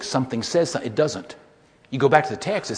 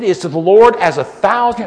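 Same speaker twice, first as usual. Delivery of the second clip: loud reading; 5 semitones higher, 11 dB louder than usual. Retold or imitated. Lady reads the verse aloud.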